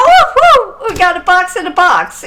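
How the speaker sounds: high, loud delighted whoo with a laugh; excited whoop. Retold or imitated. Medium to the widow on finding the box, high excited whoop. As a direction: cheer like you found treasure.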